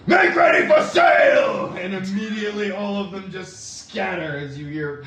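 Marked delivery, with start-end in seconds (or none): 0.1-1.7 slow booming order to sail. Acted. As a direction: call it out across the deck. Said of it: "You hear a booming voice".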